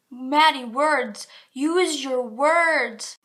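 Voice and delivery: loud, drawn-out chiding of oneself; self-scolding. Real, not acted. Vlogger catching herself fumbling the intro, mock scolding. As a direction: chide yourself slowly and loudly.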